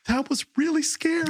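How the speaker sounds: put-on high child's voice, shaken; son's voice. Son telling dad after the swerve, high child voice. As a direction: play the scared little boy.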